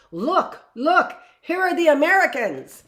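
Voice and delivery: put-on light French accent, rising excited cry; a nurse's excited French-accented cry. Nurse in France calling to her friends, French accent. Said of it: "That wasn't a bad French accent".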